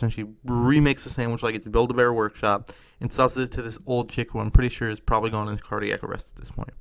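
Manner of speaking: ordinary talk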